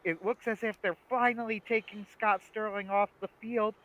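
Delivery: high, astonished play-by-play; mock sports commentator. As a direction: call it like a stunned match announcer. Acts sketch's sports commentators.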